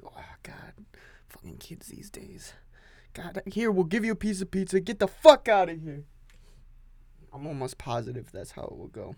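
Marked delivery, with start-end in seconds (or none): 0.0-2.5 whispered scornful aside; a disgusted whisper under the breath; muttered disgust. Retold or imitated. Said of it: "They'll be like". 3.1-6.0 raised, higher dismissive voice ending loud; mock annoyed boss. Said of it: "They'll be like".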